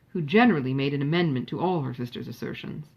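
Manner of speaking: ordinary delivery, nothing marked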